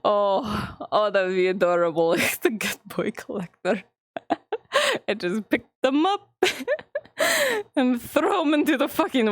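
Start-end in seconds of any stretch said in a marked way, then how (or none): 4.2-5.0 squeaky high laugh; high giggle. Real, not acted. Streamer giggling about tossing boys in water, high giggle.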